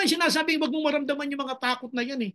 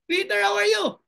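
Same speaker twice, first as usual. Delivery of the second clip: disciples calling out; 8 semitones higher, 5 dB louder than usual. Retold or imitated. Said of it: "Sabi ng ibang mga alagad".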